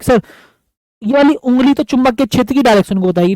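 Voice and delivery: ordinary talk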